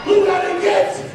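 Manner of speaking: boastful shout. Real, not acted. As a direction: yell it at the crowd. Champion boasts to the crowd.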